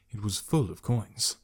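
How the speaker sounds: usual speaking voice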